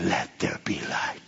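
hushed whisper. Acted. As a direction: breathe the words, barely voiced. Quoting creation words, whispered.

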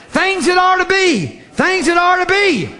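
preaching climax. Acted. Preacher to congregation about the prophecy, shouting slowly. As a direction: proclaim it with rising fervor.

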